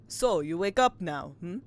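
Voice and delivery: hammy Russian sailor at normal pitch; terrible Russian accent. Acted. Player voicing a Russian sailor before learning he's a twink, bad Russian accent. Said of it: "just a terrible Russian accent".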